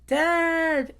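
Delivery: high, drawn-out cry of joy; Dory calling to the father, high and slow; joyful Dory. Retold or imitated. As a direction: announce the good news in delight. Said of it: "And Dory's like".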